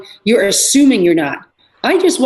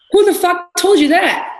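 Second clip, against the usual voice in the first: raised, indignant challenge, pitched higher; angry retort; 8 semitones higher than usual. Retold or imitated. Angry lifter challenging the women's corner setup, pitched higher. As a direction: demand to know who said it. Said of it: "I was pissed".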